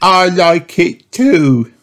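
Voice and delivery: put-on high, slow pony child; little boy voice. Pedro Pony agrees with Molly, child voice.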